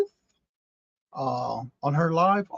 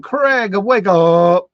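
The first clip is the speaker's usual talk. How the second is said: loud call to rouse someone; playful wake-up call; 10 dB louder, much slower than usual. Pretend. Streamer greets Craig in the chat, playful loud wake-up call. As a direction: call out loudly, as if waking him.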